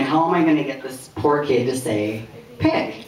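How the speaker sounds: ordinary talk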